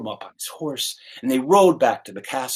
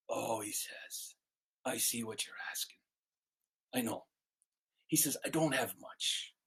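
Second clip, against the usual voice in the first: soft, understated beggar man; gently voiced humble beggar, very soft; 6 semitones lower, 17 dB quieter than usual. Beggar admits to the questioner he has little, softly humble.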